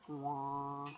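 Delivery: plain reading voice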